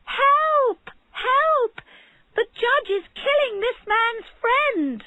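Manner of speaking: high, loud frantic cry; desperate shouting crowd; crowd crying for help. A narrator's reading. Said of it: "began to cry".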